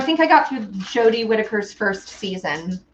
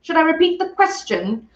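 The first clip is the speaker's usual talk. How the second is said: lightly acted badass quote, higher voice; total badass; 8 semitones higher than usual. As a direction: quote a badass laying down a challenge. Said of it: "total badass going".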